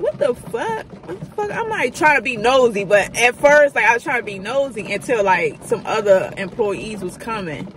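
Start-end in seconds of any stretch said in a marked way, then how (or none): 0.0-1.5 high, hushed puzzled what the fuck; a quiet startled double-take; confused what the fuck. Retold or imitated. Overhearing coworkers argue, hushed high surprise.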